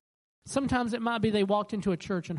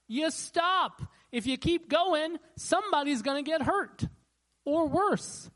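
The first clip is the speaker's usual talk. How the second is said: urgent preaching; 9 semitones higher, slower than usual. Acted. Preaching red light warning.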